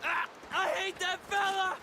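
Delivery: high, stammering plea; panicked denial. Acted. Captive pleading with the gang leader, high and panicked. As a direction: beg them to believe you.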